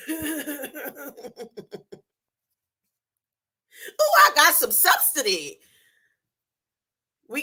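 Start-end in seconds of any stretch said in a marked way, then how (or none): 0.1-5.6 mocking a giddy servant, a touch put-on. Preacher mocking happy servants, lightly put-on giddy voice. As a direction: gloat like a servant handed free money. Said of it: "they was all happy".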